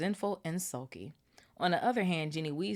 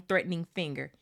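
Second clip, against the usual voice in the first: ordinary delivery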